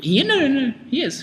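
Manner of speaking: high-pitched protest; insistent. Real, not acted. Insisting against a correction.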